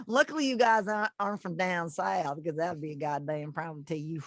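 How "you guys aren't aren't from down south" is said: normal talking voice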